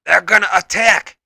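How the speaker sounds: high, loud warning cry; alarmed shout. A narrator's reading. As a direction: bark out the alarm. Feral panics before the attack.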